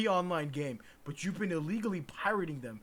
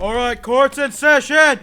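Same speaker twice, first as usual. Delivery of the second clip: loud judge; 7 semitones higher, 16 dB louder, slower than usual. Acted. Judge opens the mock trial.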